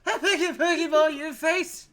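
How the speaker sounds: high, loud hammed-up Scottish shout; over-the-top Scottish challenge; Scottish accent. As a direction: bellow like a rowdy Scottish trainer. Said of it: "the Scottish Pokemon trainer".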